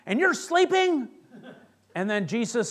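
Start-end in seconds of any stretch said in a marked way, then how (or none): 0.0-1.1 high, loud panicked outcry; mock-terrified disciples; acted panic. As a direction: wail it in hammed-up disbelief and panic. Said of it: "there's this panic".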